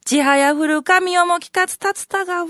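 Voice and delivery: poem recital. Shown as a demonstration. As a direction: chant the old poem with ceremony. Recites upper verse again.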